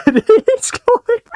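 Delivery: shrieking laugh breaking through; high cracking laughter; laughing hard. Real, not acted.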